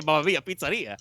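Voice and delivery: cartoon Italian accent. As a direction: toss off a stock Italian catchphrase. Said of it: "I have to say".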